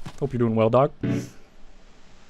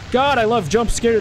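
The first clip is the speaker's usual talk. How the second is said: ordinary delivery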